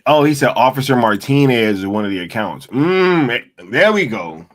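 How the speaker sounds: loud, bright realization; loud excited oh as it clicks. Real, not acted. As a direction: burst out as it clicks. Finally understands the tweet.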